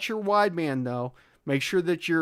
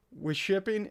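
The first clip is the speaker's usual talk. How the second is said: usual speaking voice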